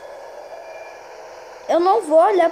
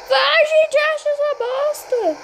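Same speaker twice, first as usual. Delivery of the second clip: frantic shout; 7 semitones higher, slower than usual. Real, not acted. Urging teammates to find it.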